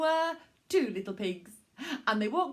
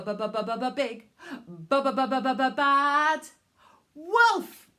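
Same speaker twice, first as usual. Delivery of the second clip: building stammer; 3 semitones higher, 5 dB louder than usual. Acted. Stuttering build to wolf.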